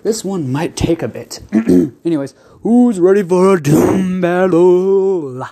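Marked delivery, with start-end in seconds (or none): 2.6-5.5 loud, slow hype announcer call; booming fighting game announcer. Acted. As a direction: draw out the question like an arena host. Opening the battle like a show.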